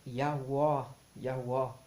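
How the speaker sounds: "Yahweh" said loudly as Yah-wó, final vowel an open O; pronunciation demo. Shown as a demonstration. Teacher giving scholars' proposed pronunciation, emphatic "Yahweh" ending in O. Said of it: "a pronúncia do nome poderia ser".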